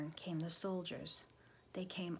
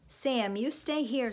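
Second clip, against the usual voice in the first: loud, raised maternal order; commanding; 5 semitones higher, 7 dB louder than usual. A narrator's reading. Mother stopping Sam as troops return, commanding. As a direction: order it urgently with pitch up.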